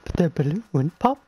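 creepy clown voice. Pretend. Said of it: "You have a great clown voice".

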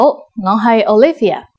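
usual speaking voice

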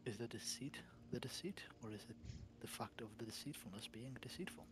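mock-profound whisper. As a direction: whisper like a riddling sage.

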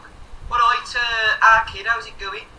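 put-on light Wolverhampton accent greeting. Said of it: "that's how people talk".

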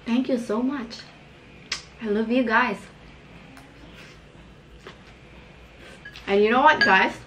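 talking around salad bite; speaking between mouthfuls; mouth full, chewing. Real, not acted. Seen 2: Forks greens into mouth repeatedly; lips pursed chewing between phrases.

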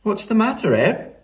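put-on high, questioning girl voice; young Flo. A narrator's reading. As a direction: ask the dog in a lifted, lively voice. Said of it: "said Flo".

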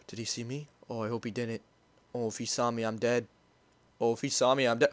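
worried he's been spotted; a touch of real fear; nervous hushed. Real, not acted. Hiding, fearing discovery.

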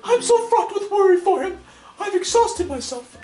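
high old lady voice full of hammed-up worry. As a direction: play the fretting old lady who lost her cat.

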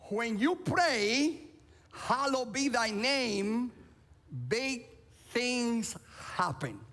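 slow, deliberate, weighty phrasing; slow emphatic recitation. Acted. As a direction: lay each word down slowly. Memorable line for congregation to repeat.

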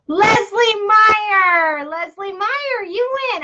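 high, drawn-out call of the winning name; game-show style winner call; excited winner announcement. Real, not acted. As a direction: sing out the winner, starting loud and stretched.

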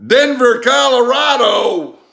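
high, loud, stretched-out cry of disbelief; can't believe Louisiana sauce is from Denver; shocked disbelief. Real, not acted. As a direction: yelp it like you can't believe it. Repeats the surprising origin.

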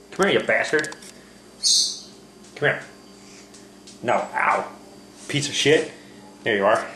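ordinary talk

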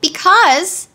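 high, loud exclamation; sudden emphatic outburst; exclaiming. A narrator's reading. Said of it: "exclaimed Dorothy".

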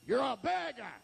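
punched, rising put-down; acted heckler's jab; scornful rebuke. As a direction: throw it at him as a jeer. Crowd scorns the beggar, first take.

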